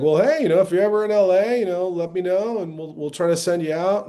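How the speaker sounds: bright sales pitch voice of an agent; agent's breezy promise. Retold or imitated. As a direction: sell it like a chirpy LA agent. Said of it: "she was like".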